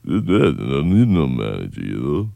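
low, slow slurred Stallone mumble. Acted. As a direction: mumble it like Rocky Balboa. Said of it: "or Stallone".

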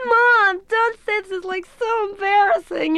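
put-on very high, whining teen protest; mortified teenage girl. Young girl begging mother to stop, high mortified whine. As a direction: pitch it way up and drag out the embarrassment. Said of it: "I wanted to play a, a young girl".

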